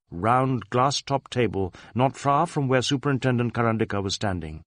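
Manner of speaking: ordinary delivery, nothing marked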